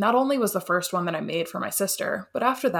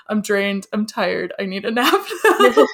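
laughing tired complaint; 3 semitones higher, 8 dB louder than usual. Retold or imitated. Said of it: "so many emotions".

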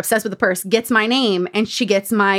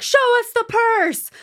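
mock photographers calling; 12 semitones higher, slower than usual. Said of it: "All the photographers are like".

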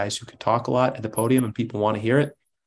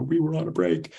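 higher-pitched mock protest; imitating Ross; 6 semitones higher than usual. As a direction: quote a sitcom character, pitched up. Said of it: "a Ross, Rachel, Friends kind of situation".

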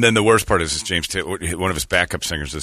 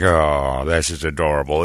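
imitated cooing crowd; 6 semitones lower than usual. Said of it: "And the crowd was like".

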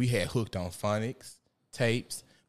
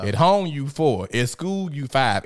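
loud, higher, slow insistent instruction; imitating a mother's emphatic repeated lesson; mom drilling it; 5 semitones higher, 7 dB louder, slower than usual. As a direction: drill it into a child, phrase by phrase. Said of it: "something my mom kept drilling in my".

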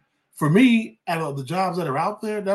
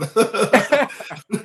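loud burst of laughter; hearty laugh; 6 semitones higher, 4 dB louder than usual. Real, not acted. Guest laughing at his own joke to the host.

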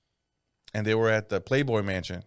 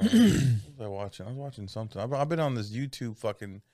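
normal talking voice

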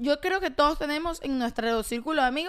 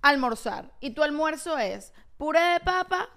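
slow, spelled-out explanation; slow, deliberate; slower than usual. Real, not acted. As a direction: lay each word out slowly. Demands attention, spells out example.

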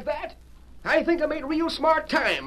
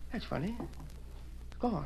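dismayed murmur; 3 semitones lower, 15 dB quieter, much slower than usual. Acted. Discovers the chair is lost.

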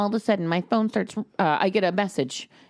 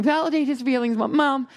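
higher, insistent appeal to mom; exasperated plea; 6 semitones higher than usual. Retold or imitated. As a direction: plead with your mother, retold. Retold plea to her mother.

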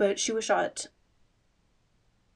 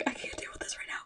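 whispering; 6 dB quieter, faster than usual. Retold or imitated. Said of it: "'cause I was like".

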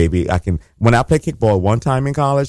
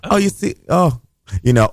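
ordinary talk, nothing marked

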